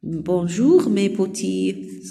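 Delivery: Bonjour mes petits drawn out very slowly, silent s in mes; very slow teacher model; slow modelled French. Shown as a demonstration. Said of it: "Silent ما رح ألفظها".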